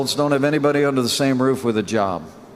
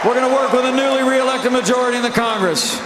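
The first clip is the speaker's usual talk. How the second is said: rally speech; 7 semitones higher than usual. Real, not acted. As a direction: rouse the crowd, voice raised.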